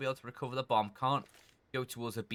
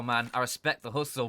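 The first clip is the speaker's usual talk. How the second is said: normal talking voice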